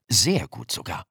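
plain reading voice

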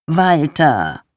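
wife losing patience with her husband; exasperated. A narrator's reading. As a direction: cut him off with an impatient call. Wife interrupts husband's lecture.

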